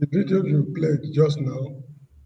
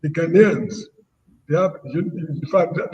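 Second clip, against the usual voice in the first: usual speaking voice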